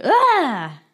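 high mock "aah!" outburst; imitated "ah!". Retelling her slamming the car door, high mock cry. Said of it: "slams the door and goes like".

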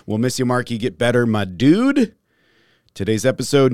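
ordinary talk

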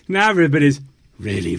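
ordinary talk

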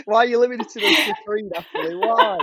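high-pitched playful protest through laughter; mock complaint. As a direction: plead in jest, last word leaping very high.

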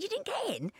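very high-pitched disbelief; mock-shocked, voice leaping up high; incredulous. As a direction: squeak it out as an astonished question. Retells her shocked reaction to friend.

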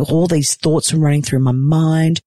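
ordinary talk, nothing marked

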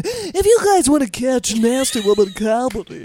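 loud, mock ad-read plug; promo announcer. Plugging the Nasty Women comedy show, loud announcer style. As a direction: punch it out like a radio ad.

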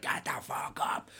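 hoarse, unvoiced growl of a half-faced ghoul; monster actor growl. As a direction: rasp it out like a scare actor pulling you up. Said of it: "and she's like".

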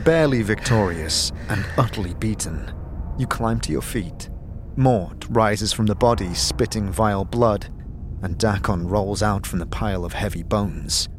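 ordinary talk

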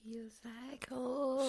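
breathy whisper of the title. Real, not acted. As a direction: whisper it softly.

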